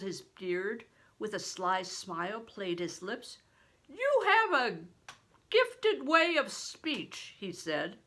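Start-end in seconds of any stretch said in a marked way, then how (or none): 3.9-7.3 higher, louder, slow booming blessing; a giant of a man, booming and slow; big stranger. A narrator's reading. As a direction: play the huge man, loud and hearty.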